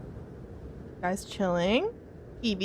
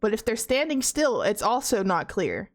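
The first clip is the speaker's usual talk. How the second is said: ordinary talk, nothing marked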